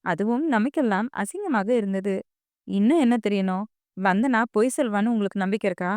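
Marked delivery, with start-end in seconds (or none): none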